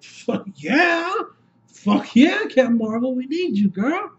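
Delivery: high, whooping fan cheer; hyped cheering. Retold or imitated. Fan cheering Captain Marvel's arrival, high hyped whoop. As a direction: egg her on, pitch way up.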